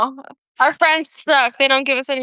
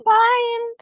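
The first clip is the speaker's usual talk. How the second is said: high echoed sign-off; 11 semitones higher than usual. Real, not acted. Echoing sign-off.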